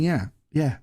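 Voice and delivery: ordinary talk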